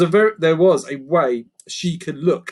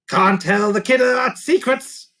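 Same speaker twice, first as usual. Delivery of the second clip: high goblin voice; secretive creaker; 10 semitones higher, 5 dB louder than usual. Acted. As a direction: keep the secret. Creaker refuses.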